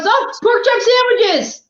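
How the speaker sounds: high, drawn-out cartoon cry; hammed-up catchphrase, pitched well up; mock alarmed exclamation. Man running down the street in a parody cartoon, mock alarmed cry.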